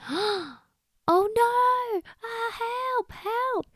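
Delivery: high-pitched drowning bunny; panicked cry for help. Acted. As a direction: wail for help as if sinking, playacted. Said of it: "coughing and shouting for help".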